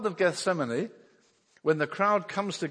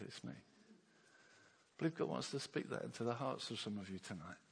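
whisper-close reassurance to a frightened friend; tender reassurance; 16 dB quieter than usual. Acted. Tender words of God to hearers.

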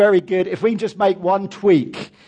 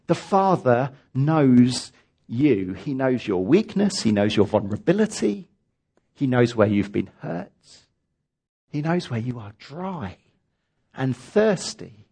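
tender; 7 semitones lower than usual. Real, not acted. Tender pastoral reassurance.

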